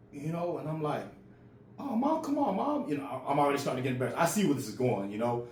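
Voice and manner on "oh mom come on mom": high whiny plea, imitated; pleading kid. Kid begging mom in front of friends, high-pitched pleading.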